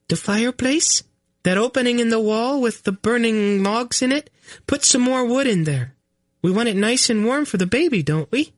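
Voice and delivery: put-on high queen voice, talking down as if to a child; patronizing queen. Queen coaxing panicked Garion, patronizing. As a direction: spell it out for a dim husband.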